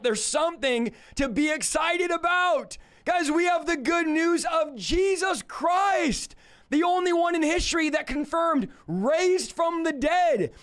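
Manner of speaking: full-force revival preaching; preaching shout. Real, not acted. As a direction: shout the good news at the room. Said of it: "Why is this guy shouting?".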